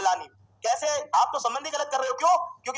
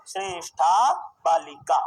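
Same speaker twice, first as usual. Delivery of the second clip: Shreshtha Balika drawn out slowly, word by word, lower; slow dictation; 6 semitones lower, much slower than usual. Shown as a demonstration. Teacher dictating the answer to the class, slow dictation. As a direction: stretch out the long vowels of Shreshtha Balika.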